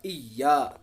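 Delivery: "इया" with a pressed, doubled y; firm, tense "इया"; hard iya. Shown as a demonstration. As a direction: shadda on the y. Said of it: "थोड़ी सख्ती करनी है इसमें".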